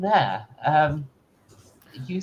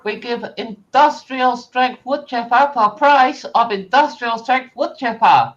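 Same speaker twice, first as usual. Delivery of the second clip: high, loud pushy salesman; foreign salesman; 9 semitones higher, 5 dB louder than usual. Acted. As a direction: sell it hard, broken English. Salesman with broken grammar.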